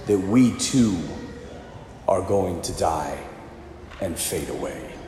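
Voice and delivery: low, quiet, sinking almost away; hushed, low sermon reflection; solemn hush. Real, not acted. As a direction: preach mortality gravely, fading to very soft. Preacher dwelling on death.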